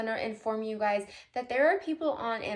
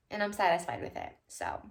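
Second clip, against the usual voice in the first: usual speaking voice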